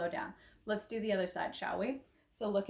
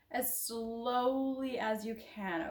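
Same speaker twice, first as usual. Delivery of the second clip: drawn-out slow cue; slower than usual. Shown as a demonstration. Instructor pacing a slow shoulder drop, drawn-out. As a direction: stretch out SLOWLY to match the movement.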